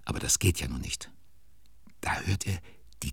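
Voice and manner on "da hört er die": breathy suspenseful whisper. A narrator's reading. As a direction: whisper it like a secret. Narrator building suspense. (flüsternd)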